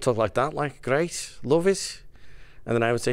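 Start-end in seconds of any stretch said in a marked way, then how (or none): 0.0-2.0 put-on light Liverpool accent, lifted at the end. Describing the family's way of talking, Liverpool accent. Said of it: "we had a Liverpool accent".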